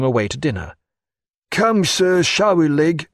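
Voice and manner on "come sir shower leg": angry steward. Acted. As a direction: scold the doctor to hurry up. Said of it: "said Killick angrily".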